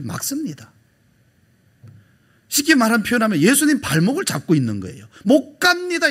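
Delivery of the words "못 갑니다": a hint of Peter's stubborn refusal, high and loud; Peter protesting. Peter refusing to let Jesus go, high insistent protest. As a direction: blurt it out like a stubborn disciple.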